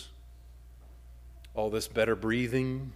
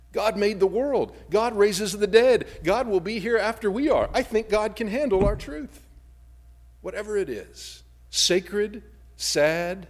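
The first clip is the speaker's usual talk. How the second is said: higher, louder proclamation; full-force preaching; 9 semitones higher, 6 dB louder than usual. Acted. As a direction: proclaim it over the congregation. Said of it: "preaching at full force".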